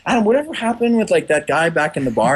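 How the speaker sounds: put-on high, egging-on voice of an old buddy; teasing friends. Old roommates setting Adam up to tell a story, teasing. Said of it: "be like".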